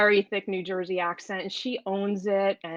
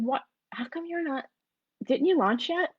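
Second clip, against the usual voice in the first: higher-pitched, retold disbelief; puzzled friend; 7 semitones higher than usual. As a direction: imitate a friend's baffled questioning. Friend questions why she hasn't launched.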